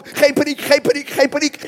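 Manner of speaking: loud, high, repeated urgently; hammed-up panic while denying it; panicked self-calming. Preacher as frightened king steadying himself, mock panic. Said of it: "Ik ben niet in paniek".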